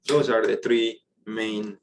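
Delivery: ordinary talk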